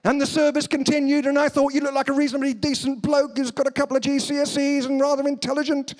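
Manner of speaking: high monotone intoning, dropping at the sentence end; mock liturgical drone on a high note; vicar voice. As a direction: mimic a chanting vicar. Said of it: "doing this thing where you go down".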